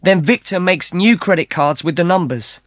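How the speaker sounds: high, quick excited explaining; eager explaining. Acted. Matt and Kate explain the card scam to the brothers, high and eager. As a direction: rattle it off eagerly.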